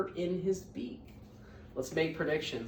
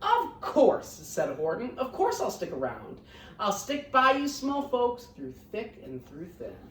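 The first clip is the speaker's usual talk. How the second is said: lively read-aloud storytelling; picture book reading voice; story narration; 10 dB louder than usual. A narrator's reading. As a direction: read it to the class with bounce.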